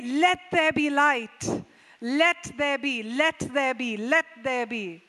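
slow, weighty Let there be; slow, each Let hit hard; commanding decree. Acted. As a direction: proclaim it like a divine command. Preacher voicing God's decree.

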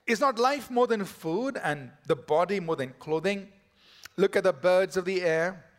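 loud, ringing pulpit reading of the Gospel; preaching scripture reading. Acted. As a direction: proclaim the passage to the congregation. Reads the scripture passage aloud.

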